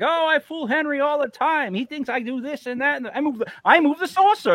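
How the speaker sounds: imagined gossiping grandmother. Pretend. Grandmother bragging to her friends about fooling Henry, high-pitched mock gossip. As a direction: play a prankster boasting in a high voice. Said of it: "talked about it with her friends".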